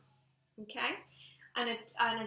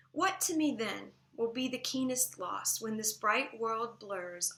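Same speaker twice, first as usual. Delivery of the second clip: louder, measured poetry recitation; poem read aloud; 6 dB louder than usual. Acted. Teacher reading a sonnet to the class, projected poetry recitation. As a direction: recite it strongly, leaning into the key words.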